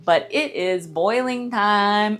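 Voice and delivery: slow, stretched own voice; drawn-out announcement. Real, not acted. Host returning to the boiling step, drawn-out announcement. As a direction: draw out "boiling time" in your own voice. Same line outside the monster voice.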